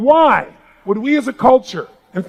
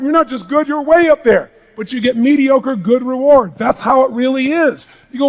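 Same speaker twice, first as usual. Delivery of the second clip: ordinary delivery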